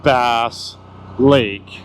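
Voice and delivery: slow, drawn-out ceremonial announcement; grand announcer. Pretend. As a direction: present it like an emcee, spacing out the big name. Mock formal unveiling of the lake.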